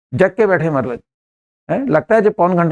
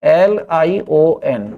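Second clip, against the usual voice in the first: spelling it out slowly for students; much slower than usual. Shown as a demonstration. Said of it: "ध्यान से सुनना".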